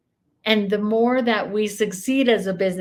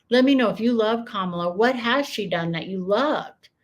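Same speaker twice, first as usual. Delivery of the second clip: ordinary talk, nothing marked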